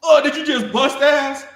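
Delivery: high, loud disgusted yelp; grossed-out sergeant. Retold or imitated. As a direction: squeal it out, revolted. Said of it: "And Sergeant Santiago goes".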